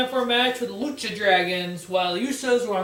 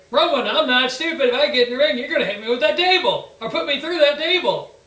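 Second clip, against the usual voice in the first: mock Sheamus; 5 semitones higher, 6 dB louder, faster than usual. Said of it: "Sheamus goes".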